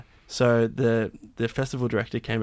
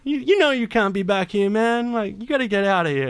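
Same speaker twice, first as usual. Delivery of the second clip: security guy voice; 11 semitones higher, 4 dB louder than usual. Retold or imitated. Security guy ordering him out.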